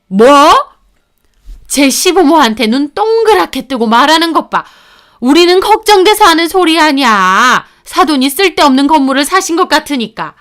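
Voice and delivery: mother-in-law scolding the daughter-in-law, indignant. Acted. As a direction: snap back, offended.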